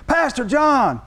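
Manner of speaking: high, enthusiastic hail; eager greeting. Retold or imitated. Young man greeting the visiting pastor, eager. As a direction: imitate a delighted greeter calling out.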